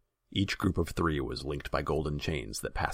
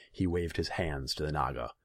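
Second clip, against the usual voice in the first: ordinary delivery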